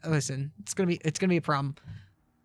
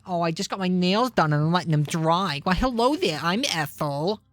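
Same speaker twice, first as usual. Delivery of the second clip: put-on New York woman's accent. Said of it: "talk as if you just had your nails done".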